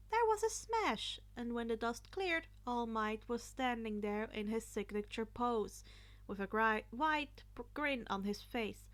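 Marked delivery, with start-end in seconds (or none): none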